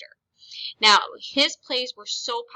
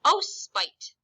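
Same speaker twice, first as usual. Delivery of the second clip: nasty; 9 semitones higher than usual. Shown as a demonstration.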